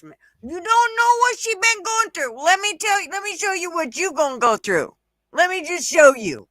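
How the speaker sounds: high sarcastic imitation; mimicking her defender. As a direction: parrot her words back in a sneering high voice. Mimicking her defender, then threatening.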